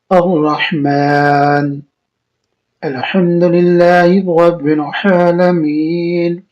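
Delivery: الحمدللہ رب العالمین recited slowly with Arabic throat sounds; tajweed recitation. Shown as a demonstration. Teacher models تجوید recitation of الحمدللہ for students. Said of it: "قرآن تجوید سے پڑھیں گے".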